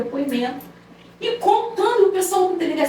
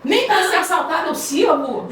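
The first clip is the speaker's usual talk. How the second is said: higher mock offense, laughing; mock offended insistence; 5 semitones higher than usual. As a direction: insist on being robbed, playfully hurt. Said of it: "Aí eu falei". (ofensa fingida, aguda e risonha)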